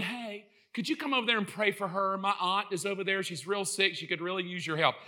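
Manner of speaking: understated acted request for help; soft casual request. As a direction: ask softly, as if pulling someone aside. Voices someone casually asking for prayer.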